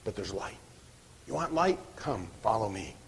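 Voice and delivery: low, hushed appeal as Jesus; gentle invitation. Acted. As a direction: softly coax them to come. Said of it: "gently saying".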